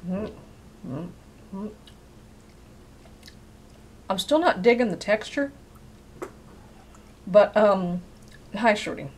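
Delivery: eating while speaking; talking while chewing. Real, not acted. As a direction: mouth partly full. Seen 2: Holds bitten jerky, jaw moving, grimacing while talking through mouthful.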